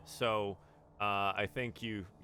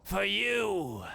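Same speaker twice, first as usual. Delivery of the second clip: madcap offering; 9 semitones higher, 3 dB louder than usual. Acted. Madcap presenting the finger.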